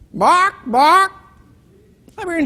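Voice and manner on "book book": two high clucked bocks; a hen clucking for more books; chicken clucking twice. Acted. Chicken back at the librarian's desk, high double cluck.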